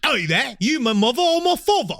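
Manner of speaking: hammed-up, wobbly English accent; fallen-off UK English accent. Shown as a demonstration. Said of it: "My U K English accent has fallen off".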